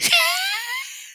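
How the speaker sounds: high burst of laughter; big laugh. Real, not acted. Vlogger laughing at her own granola joke, big laugh.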